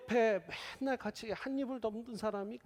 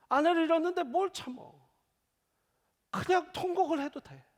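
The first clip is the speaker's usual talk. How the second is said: high, loud pleading cry; impassioned plea; 10 semitones higher, 4 dB louder than usual. Acted. Pastor pleading with a grieving widow to wail, loud and high. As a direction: urge them to let it out. Pastor telling mourners to weep.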